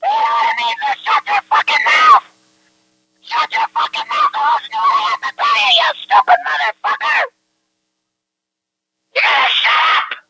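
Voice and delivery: high-pitched screamed fury; losing it, full-throated angry shrieking; enraged screaming. Real, not acted. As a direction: scream them into silence, voice way up. Enraged, demanding the other listen.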